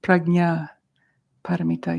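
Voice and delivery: prajna as "prag-nya", a beat, then paramita; hard-g prajna, paramita set apart. Shown as a demonstration. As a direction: model prajna with a hard g for learners. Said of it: "that's like a G".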